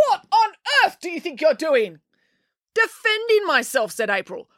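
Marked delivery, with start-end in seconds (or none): 0.1-2.0 much higher, outraged; snapping. Acted. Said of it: "he snapped". 2.7-3.9 defiant. Acted. April caught fighting.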